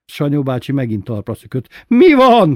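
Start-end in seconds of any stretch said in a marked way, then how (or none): 1.9-2.5 high, loud startled cry; jolted awake and scared, yell it; frightened shout. A narrator's reading. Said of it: "kiáltott rémülten".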